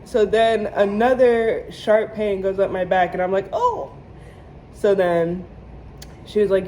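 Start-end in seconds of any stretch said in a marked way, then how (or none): 3.5-3.9 a high yelp of an oh; startled oh. Retold or imitated. Patient hit by a second jab, high startled oh. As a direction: jump up in pitch at the sudden pain. Another sharp pain up her back.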